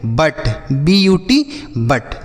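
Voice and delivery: एच यू टी spelled letter by letter, slowly, then हट; slow spelling out. Shown as a demonstration. Teacher spelling a word for children, slowly. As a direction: sound out each letter slowly for the class. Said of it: "इसे हम पढ़ेंगे".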